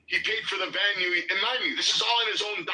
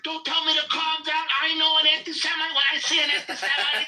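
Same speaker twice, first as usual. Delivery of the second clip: high, louder mock hysterics; mock panicked outrage; 10 semitones higher, 4 dB louder than usual. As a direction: imitate an irate, worked-up caller protesting at a higher pitch. Retelling panicked, irate callers.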